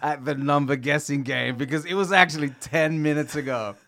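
high, loud, laughing taunt; gloating. Retold or imitated. Teasing the girlfriend's family about the missed number, loud gloating. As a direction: rub it in with a cackle.

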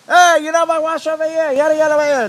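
high, loud scolding scream; an aunt screaming at trainees for sloppy washing; screaming auntie. Retold or imitated. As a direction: yell at the students like a furious aunt. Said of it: "Auntie Margaret screaming".

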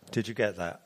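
ordinary talk

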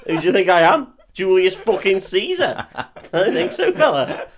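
high, loud scornful refusal; mock affronted. Customer refusing the free table, mock affronted. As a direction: act insulted and hammed-up, ending in a laugh.

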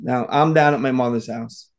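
ordinary delivery

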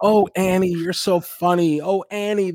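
high gushing mock praise; gushing friends. Friends fawning over Annie's video, high and gushing.

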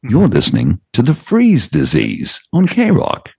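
high, slow, swooping English radio announcer; English-accented station ID. Acted. DJ voicing a jingle for K-Rock, hammed-up English announcer. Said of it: "because of the English accent".

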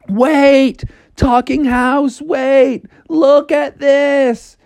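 high, drawn-out urgent calling; pleading Froggy. Acted. Froggy calls to the house while floating away, high and urgent. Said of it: "just as Froggy was floating away, he was like".

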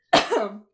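sharp cough clearing the throat; choking cough. Real, not acted. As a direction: cough out the tea. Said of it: "the tea went down the wrong pipe".

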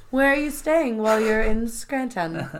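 coy, careful "Scranton" with the T sounded; affected pronunciation. Retold or imitated. Kelly being demure for Charles, affected "Scranton". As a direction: put on dainty care for "Scranton". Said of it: "The way she said".

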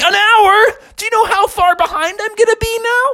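very high, alarmed voice; shocked panic. Acted. Bear panicking at the horse, very high. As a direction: panic at the lost time.

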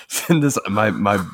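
normal talking voice

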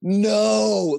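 high-pitched, dismayed protest; appalled reaction. Retold or imitated. Onlooker reacting to a dad's scolding, appalled. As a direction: pitch it way up in retold disbelief.

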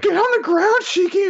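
very high, squeaky panicked hiss; high-pitched comic panic; mock frantic urging. Frantic teammate urging Shiki down.

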